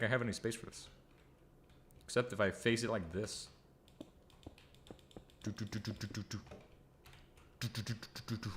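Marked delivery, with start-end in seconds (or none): 5.4-8.5 soft, even "do do do"; quiet staccato doo-doos to oneself; absent-minded soft vocal filler; idle doo-dooing. Real, not acted. Idly fills time while working.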